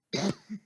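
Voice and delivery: a sharp single cough. Acted. Host apologising to listeners on air, a sharp cough. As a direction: clear the throat with a hard cough.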